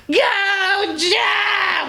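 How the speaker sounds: very high shrill yell imitating a ringside fan; loudest voice. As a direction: shriek it out like her, drawn out and piercing. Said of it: "like, the loudest voice".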